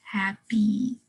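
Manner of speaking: "happy" sounded out to its final E; E sound shown. Shown as a demonstration. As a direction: demonstrate "happy" in two parts, ending on a clear ee. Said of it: "to make the E sound".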